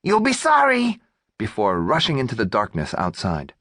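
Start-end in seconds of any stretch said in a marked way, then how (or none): 0.0-1.0 high squeaky threat shouted. A narrator's reading.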